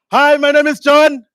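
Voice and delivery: livelier call. Shown as a demonstration. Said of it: "let's give it more life".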